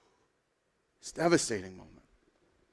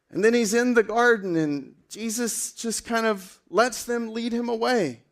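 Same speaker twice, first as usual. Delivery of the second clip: ordinary talk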